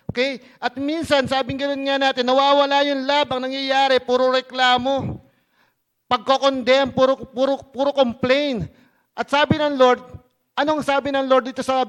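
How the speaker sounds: loud, insistent sermon voice; emphatic preaching. Real, not acted. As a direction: preach it forcefully to the congregation. Preaches against complaining.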